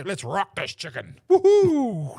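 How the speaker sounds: hyped-up catchphrase ending in a high, loud woohoo; excited rallying cry. Acted. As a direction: fire the crowd up with a whooping call. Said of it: "so I say".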